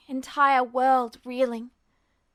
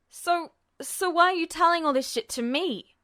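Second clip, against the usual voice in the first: higher, angry retort; shouting back; 4 semitones higher than usual. A narrator's reading. Ed shouting at Truth, higher and angry. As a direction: throw the question back hard. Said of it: "he shouted back".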